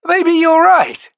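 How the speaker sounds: put-on drawn-out nervous young man; slow anxious high voice; high slow worried voice. A narrator's reading. Murillo's nervous reply.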